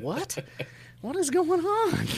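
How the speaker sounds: high, rising put-on bewilderment; mock bafflement. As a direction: act comically baffled by odd advice. Acts out baffled festival-goer.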